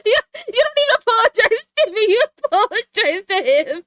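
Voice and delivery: high, loud, fast outraged complaint; outraged yelling. Real, not acted. Telling off a player who won't apologize, high outraged yell. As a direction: yell it in disbelief.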